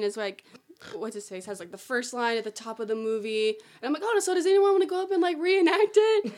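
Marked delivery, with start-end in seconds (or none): 4.0-6.3 high, loud upbeat pitch to the group; tour guide inviting. Retold or imitated. Fake guide asking tourists to reenact, put-on eagerness. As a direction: rush in, then draw out the last words.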